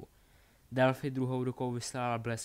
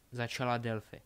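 plain reading voice

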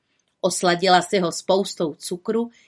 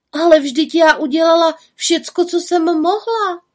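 put-on high, louder girlish protest; defensive little witch; 9 semitones higher, 6 dB louder than usual. A narrator's reading. Little witch protests to the raven, defensive. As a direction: plead that you did your best.

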